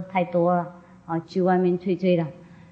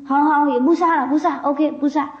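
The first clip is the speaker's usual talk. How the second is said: raised-pitch grudging agreement; 7 semitones higher than usual. Retold or imitated. Chieftain reluctantly agrees.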